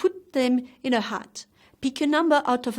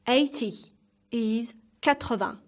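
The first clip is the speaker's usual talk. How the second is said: normal talking voice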